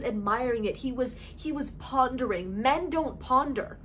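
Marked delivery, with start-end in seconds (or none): none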